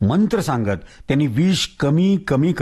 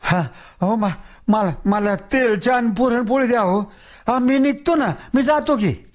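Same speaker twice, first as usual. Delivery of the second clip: higher, bargaining whine; wheedling ghost; 8 semitones higher than usual. Acted. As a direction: beg and bargain. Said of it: "थापा टाकत म्हणालं".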